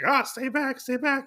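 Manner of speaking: mock panic. Voices gambler fending off attackers.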